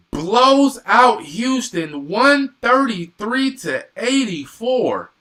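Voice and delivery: high, loud, drawn-out score call; astonished announcement. Real, not acted. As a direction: stretch out the score, loud and amazed. Stunned by a blowout score.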